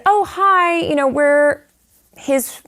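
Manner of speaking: high, eager and unsure hello; high-pitched awkward hello to a stranger; nervous greeting. Retold or imitated. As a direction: fake casual brightness at the door. Nervous visitors at the door.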